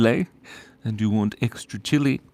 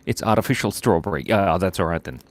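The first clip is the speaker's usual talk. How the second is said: ordinary delivery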